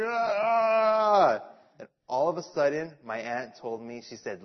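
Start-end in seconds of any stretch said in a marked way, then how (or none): 0.0-1.4 high mock whimper of a scared boy; a little boy's whine, higher; childish whimper. Aunt insists, boy whines a high mock whimper.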